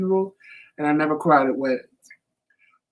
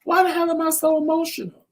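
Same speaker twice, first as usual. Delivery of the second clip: much higher, exasperated what-the-hell; mock self-scolding, pitched way up; baffled at herself; 10 semitones higher than usual. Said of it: "I'm like".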